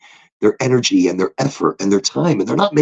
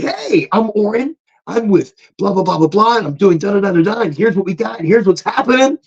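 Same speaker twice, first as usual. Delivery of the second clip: high, energetic sales intro; pumped-up pitch; 8 semitones higher than usual. Retold or imitated. Rep introducing himself to the assistant, high and pumped. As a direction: burst in full of energy. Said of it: "shook out like a cheetah".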